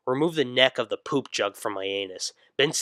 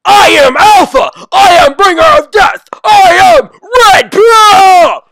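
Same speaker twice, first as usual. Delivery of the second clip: shrieking, far higher and far louder; screaming; 19 dB louder than usual. Acted. Son screams his triumph after swallowing the pills, shrieking. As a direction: scream it like a crazed triumph cry.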